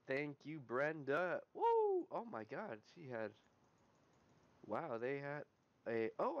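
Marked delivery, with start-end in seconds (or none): 1.5-2.1 short, high, loud "woo"; excited whoop. Real, not acted. Gamer celebrating a free emblem upgrade, excited whoop.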